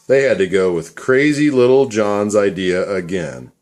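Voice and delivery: plain reading voice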